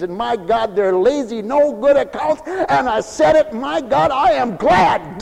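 loud, high preaching at full force; fiery preaching. Acted. Preacher scolding lazy Christians in a loud fiery sermon. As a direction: drive it home to the congregation.

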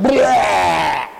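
loud retching blurt; mock vomit. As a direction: blurt out a baby throwing up. Baby vomits on his face.

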